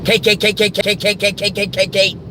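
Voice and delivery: high, rapid-fire staccato syllables; silly rapid repetition. Acted. Rapid silly gag on John.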